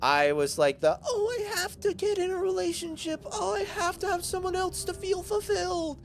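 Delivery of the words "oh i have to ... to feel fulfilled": put-on very high, whiny falsetto teenager; hammed-up squeaky pining; mock hopeless romantic. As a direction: swoon like a lovesick teen girl in falsetto. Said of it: "the hopeless romantic".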